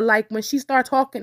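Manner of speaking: normal talking voice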